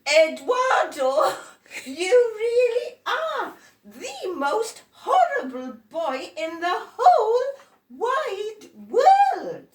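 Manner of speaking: high, loud, slow outraged cry; exasperated shout. Acted. Adults shouting at Eduardo at the climax, high outraged cry. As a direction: yell it out at the worst boy.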